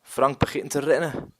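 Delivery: usual speaking voice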